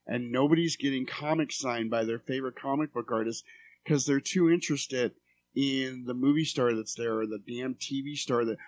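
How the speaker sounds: normal talking voice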